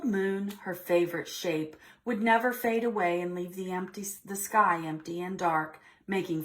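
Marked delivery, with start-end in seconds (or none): none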